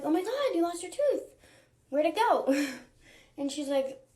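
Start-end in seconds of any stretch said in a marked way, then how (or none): 0.0-2.7 high, bright-eyed disbelief; excited surprise. Retold or imitated. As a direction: gasp it out like big news. Mom reacting to lost tooth.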